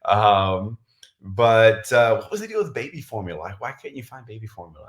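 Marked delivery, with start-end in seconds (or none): 2.2-4.9 rapid-fire rambling aside; talking really fast. Real, not acted. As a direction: rattle off the questions quickly. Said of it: "I'm talking really fast".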